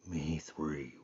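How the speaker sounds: very soft hushed bear whisper. Acted. Bear whispering to Rabbit, very soft hushed whisper. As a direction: hush it so Rabbit's ears rest. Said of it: "whispered Bear".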